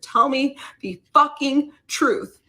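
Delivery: yelling at her husband in his face; scream. Retold or imitated. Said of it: "I scream in his face".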